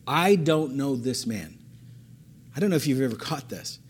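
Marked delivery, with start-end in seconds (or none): 0.1-1.6 slow, deliberate denial; drawn-out, measured delivery; slow reading. Shown as a demonstration. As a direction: read it slowly, word by word. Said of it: "let me read it again slowly".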